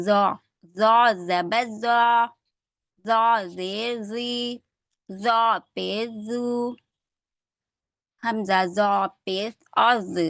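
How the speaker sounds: زو said full-mouthed and heavy, very slow; teacher drilling the heavy letter zo slowly. Shown as a demonstration. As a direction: fill the mouth and press out زو. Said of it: "زو تھوڑا موٹا ہے".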